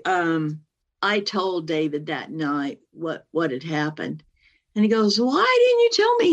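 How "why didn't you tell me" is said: high loud hurt question; loud high pained reproach; upset husband. Retold or imitated. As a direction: cry out the question in dismay. Husband upset she hid it.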